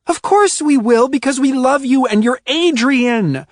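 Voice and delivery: high, loud emotional rush; worked-up yell. A narrator's reading. Ruby yelling her loyalty at Adrian. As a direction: pour it out at the top of her voice. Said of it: "She was still screaming".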